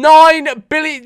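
mock amazed exclamation. Exaggerating subscriber count.

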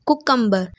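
कुकम्बर as ku-KUM-ba; common mispronunciation. Shown as a demonstration. Teacher quoting another heard error, mispronounced. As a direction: say कुकम्बर with a short ku, no y. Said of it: "लोगों से सुना है".

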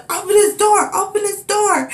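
high, insistent mock shout; steady police yelling. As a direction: keep yelling the order at the door. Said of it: "policeman is steady yelling".

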